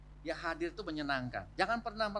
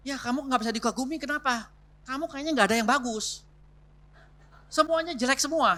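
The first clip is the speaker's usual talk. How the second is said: higher and louder, scornful nagging; mock criticizing wife; 7 semitones higher, 6 dB louder than usual. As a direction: scold him like a fed-up wife who finds nothing good. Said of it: "jangan begini juga". (istri yang mengomel dengan nada lebih tinggi dan keras)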